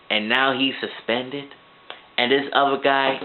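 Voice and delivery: ordinary delivery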